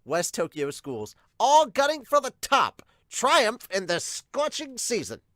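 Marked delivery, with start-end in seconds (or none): 1.4-5.2 high, loud dramatic promo voice; hype announcer. Pretend. Host hyping the schools' summer battle, grand announcer delivery. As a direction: belt it out like a tournament trailer.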